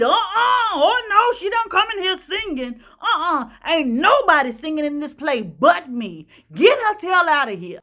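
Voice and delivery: high, loud, scornful refusal with a laugh; imitating a diva's outburst. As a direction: throw the newcomer out. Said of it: "[name] stood up in her seat".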